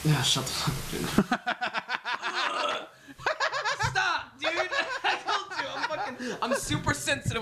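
laughing. Real, not acted. Cracking up after the tasting.

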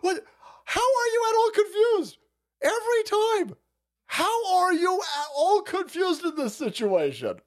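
high, exasperated yell; incredulous yell. Real, not acted. Streamer yelling at the robber on screen in disbelief. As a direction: shout at him in disbelief.

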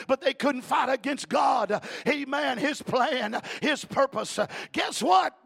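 loud, high hollering sermon with a gasped "uh" after each phrase; full-force preaching. Acted. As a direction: preach it out loud to the whole church.

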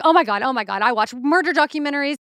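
hammed-up alarmed sister on the phone; mock panic, words tumbling out fast; freaking out. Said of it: "She's freaking out".

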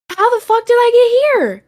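high, loud, bewildered outburst; shocked confusion. Retold or imitated. Waking in a hospital room, stunned to be there, high shocked cry. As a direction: voice shooting up in disbelief. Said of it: "And I was like".

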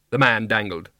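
ordinary delivery

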